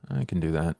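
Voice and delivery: ordinary delivery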